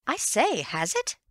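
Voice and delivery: high, loud I say, then has it; excited. Acted. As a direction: burst out eagerly with I say. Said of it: "getting excited again".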